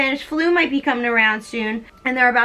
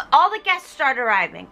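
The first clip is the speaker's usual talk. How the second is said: ordinary talk, nothing marked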